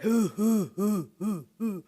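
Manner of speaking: soft, low hu hu chuckle, fading; demo chuckle. Shown as a demonstration. Showing students a second laughing character, a soft low chuckle. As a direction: chuckle quietly under your breath. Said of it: "Il riait".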